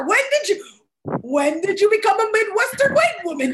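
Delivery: high, loud incredulous grilling; mock outrage. Daughter grills mom on turning Midwestern, mock outrage. Mock confronting mother about accent.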